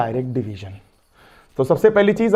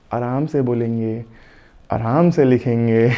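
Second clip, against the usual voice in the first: slow, low, calm self-reminder; 8 semitones lower, slower than usual. Real, not acted. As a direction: ease off the pace deliberately. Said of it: "अब मैं अपनी writing में सुधार लूंगा". (धीमे, शांत स्वर में खुद से कहना)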